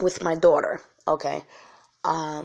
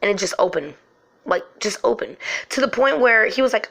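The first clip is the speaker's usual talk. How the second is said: ordinary talk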